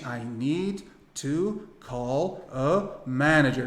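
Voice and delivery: very slow, one word at a time; painstaking learner's word-by-word English; unlinked words. Shown as a demonstration. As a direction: separate every word with a clear gap. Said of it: "nenhum americano fala assim".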